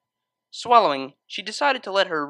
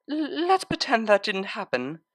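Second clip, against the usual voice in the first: higher, flustered stammer; embarrassed Octavia; 7 semitones higher than usual. Acted. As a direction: blurt it out flustered.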